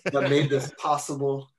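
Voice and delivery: loud, grand award-winner's thanks through laughter; hammed-up acceptance speech; mock acceptance speech. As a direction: play the humbled winner, laughing. Mock acceptance speech for best joke.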